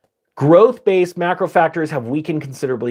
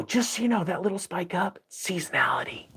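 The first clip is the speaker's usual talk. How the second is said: hushed, sinking into a whisper; confiding aside; 4 semitones higher, 10 dB quieter than usual. Shown as a demonstration. Teacher letting viewers in on the spike's cause, confiding whisper. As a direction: drop the volume and whisper the last word like a secret.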